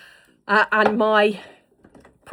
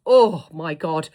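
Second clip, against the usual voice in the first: slow, drawn-out louder gush; drawn-out delighted oh my God; 4 semitones lower, 8 dB louder, slower than usual. Real, not acted. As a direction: swoon over the lipstick colour. Gushing over a lipstick.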